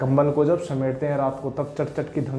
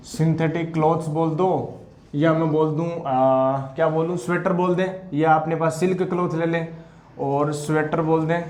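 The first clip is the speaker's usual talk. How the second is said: normal talking voice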